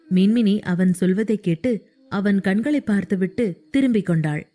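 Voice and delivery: usual speaking voice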